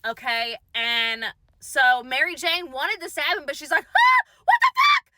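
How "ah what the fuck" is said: a much higher, louder startled shout; shocked shriek. Retold or imitated. Mother shrieks at her daughter's ghost, high shocked yell. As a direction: scream it as the ghost appears.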